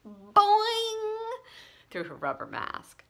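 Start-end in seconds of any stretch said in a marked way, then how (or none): 0.1-1.3 high loud playful boing; high cartoon boing yell. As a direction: yell it while tweaking a curl. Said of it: "she yelled".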